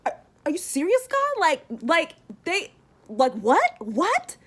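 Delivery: high, disbelieving squeaky protest; incredulous Jonah. Retold or imitated. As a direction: play Jonah gaping at God's mercy. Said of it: "And then Jonah's like".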